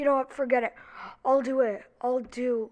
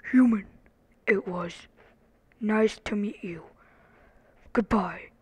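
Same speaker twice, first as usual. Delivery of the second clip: loud start, then a whispered farewell; whispered character voice; 5 semitones lower, 8 dB louder than usual. Acted. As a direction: whisper the goodbye. Character line, whispered goodbye.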